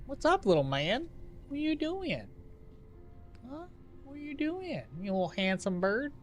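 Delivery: high, soft cooing pet voice. Real, not acted. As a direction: coo over the handsome bird. Talking to his chicken.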